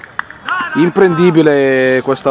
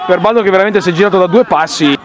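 breathless italian goal commentary; 6 semitones higher than usual. Real, not acted. Breathless goal replay description.

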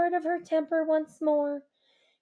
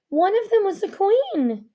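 ordinary delivery, nothing marked

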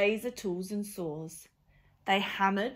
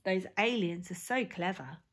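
usual speaking voice

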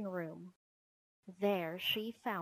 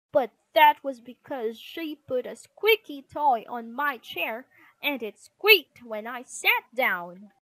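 loud, indignant excuse; raised-voice sibling retort; brother arguing back; 4 semitones higher, 10 dB louder than usual. Acted. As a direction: complain loudly about your sister's prank. Brother argues back in quarrel.

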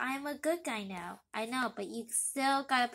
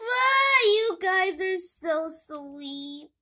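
high, loud, slow weepy gush; tearful; 7 semitones higher, 10 dB louder, much slower than usual. Acted. Bystander weeps at father and son, high and loud. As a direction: gush through tears. Onlooker moved to tears.

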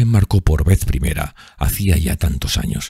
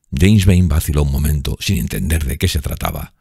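ordinary delivery

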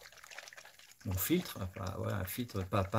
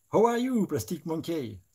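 put-on much higher, loud English-accented greeting; hammed-up English accent; 8 semitones higher, 7 dB louder than usual. Painter greets Plastic Monkey in a hammed-up English accent. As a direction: sing out the name in a comic English accent.

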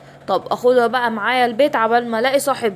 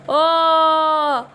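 long drawn-out groan of transformation; 3 semitones higher, much slower than usual. Acted. Injected, transforms into Hulk.

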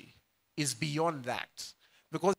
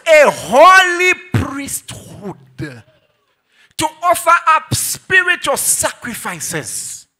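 loud, high, slow proclamation; slow, high, shouted scripture; shouted preaching; 11 semitones higher, 16 dB louder, much slower than usual. Acted. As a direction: shout the verse out slowly. Preaching at full force.

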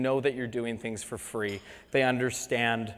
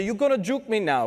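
higher, badly imitated Jamaican accent; terrible Jamaican accent; 7 semitones higher, 3 dB louder than usual. Imitated Jamaican patient asking about a needle, bad Jamaican accent. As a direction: ask it in a mock Jamaican accent. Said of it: "I'm gonna do terrible Jamaican accents".

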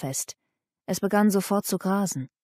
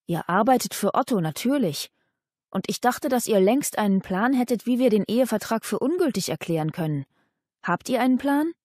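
ordinary delivery, nothing marked

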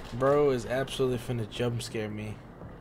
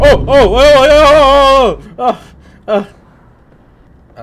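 startled screaming; 13 semitones higher, 21 dB louder, slower than usual. Real, not acted. Jump scare reaction.

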